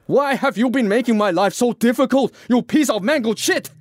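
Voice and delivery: high-pitched, sustained outraged shrieking; furious shriek. Acted. Francis rages at the Irishman, shrieking. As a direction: screech the accusation in a very high voice. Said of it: "Francis shrieked".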